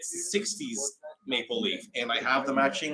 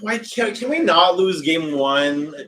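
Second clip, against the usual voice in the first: slow, louder desperate plea; pleading; 4 semitones higher, 7 dB louder, slower than usual. Real, not acted. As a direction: beg the team, drawn out. Pleading with the hockey team.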